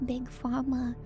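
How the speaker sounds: normal talking voice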